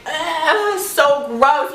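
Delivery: high, drawn-out revolted ugh; real disgust. Real, not acted. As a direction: recoil from the memory in disgust. Disgusted while recalling the incident.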